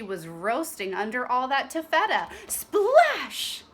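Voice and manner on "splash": loud high splash; splash sound. Acted. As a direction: make the splash big. Sound effect of diving.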